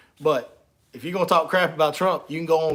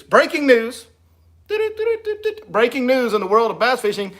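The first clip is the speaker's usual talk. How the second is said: 1 mock news announcer; 6 semitones higher than usual. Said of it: "I do have breaking news".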